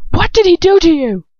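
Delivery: high, loud urgent demand; harsh demand. Acted. Lucius demands to know what happened, loud and high. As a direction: bark the question. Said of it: "his voice harsh".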